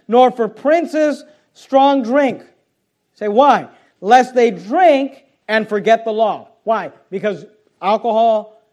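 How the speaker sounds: slow, loud, emphatic pulpit reading of the verses; forceful scripture reading. Acted. Pastor reads the proverb to the congregation, slow and forceful.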